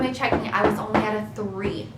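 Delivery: normal talking voice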